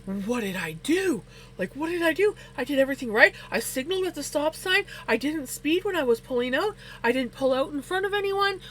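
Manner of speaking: high, panicked mock protest; retold freak-out. Driver retelling being pulled over by a cop, freaking out. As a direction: plead innocence in a rising, worked-up voice. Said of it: "I'm, like, freaking out".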